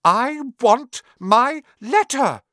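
slow, high shout, word by word. A narrator's reading. Boy demanding his letter from uncle, shouting. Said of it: "he shouted".

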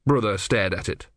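usual speaking voice

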